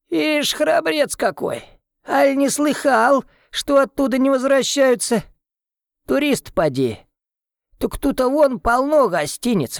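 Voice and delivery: put-on high, wheezy old villager warning; a shuffling old man scolding, pitched high; breathless old person. Old man warns Tim away.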